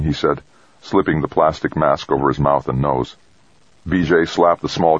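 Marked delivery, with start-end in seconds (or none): none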